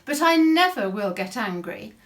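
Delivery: usual speaking voice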